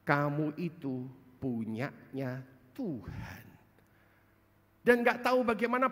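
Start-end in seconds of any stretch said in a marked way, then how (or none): 0.0-3.4 slow, soft and lower; gentle divine words. Retold or imitated. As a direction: reassure her slowly and tenderly. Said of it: "Karena Tuhan bilang sama saya".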